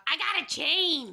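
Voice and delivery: high, excited yell; yelling into the rock. Acted. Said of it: "jumps up really quickly and yells".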